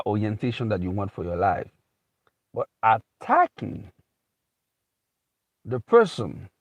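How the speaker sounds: ordinary talk, nothing marked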